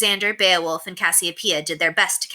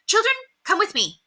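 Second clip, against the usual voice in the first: order. A narrator's reading. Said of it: "she ordered".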